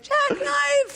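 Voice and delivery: very high mock whoop; imitating an oblivious show-off's shriek; diving call. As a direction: yell it gleefully as you leap into the pool. Bachelor yells a dive mid-confession.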